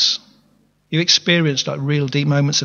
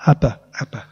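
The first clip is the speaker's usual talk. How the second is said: put-on child's mumbling; 3 semitones lower than usual. Shown as a demonstration. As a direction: mumble it like a small child saying dad. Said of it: "a child's first mumblings".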